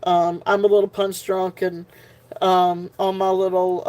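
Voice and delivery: usual speaking voice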